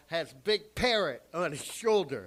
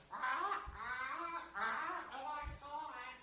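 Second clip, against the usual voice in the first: high, soft parrot squawk; 7 semitones higher, 16 dB quieter, slower than usual. Acted. Comedian attempting the captain's parrot, soft high squawk. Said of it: "The parrot goes".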